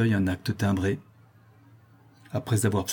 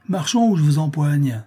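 raised, impatient command; brusque threat, higher-pitched; threatening order; 5 semitones higher, 4 dB louder than usual. A narrator's reading. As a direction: order him to move or else. Bailiff threatens to seize him.

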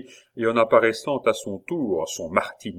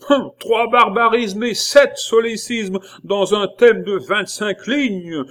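higher, louder, scornful, slowing at the end; angry scolding; 12 semitones higher, 7 dB louder than usual. A narrator's reading. Abbé counting the pupil's mistakes in anger. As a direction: scold him over each mistake. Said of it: "la voix courroucée".

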